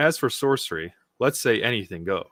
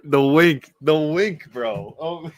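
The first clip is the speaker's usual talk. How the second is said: amused; 5 semitones higher, 4 dB louder, much slower than usual. Real, not acted. Reacting to a wink panel.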